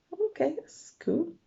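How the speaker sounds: lightly voiced teen. A narrator's reading. Narrator's reply to Guppy.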